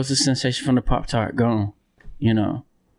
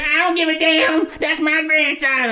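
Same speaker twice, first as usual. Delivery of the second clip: grandma voice. Retold or imitated. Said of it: "do her voice".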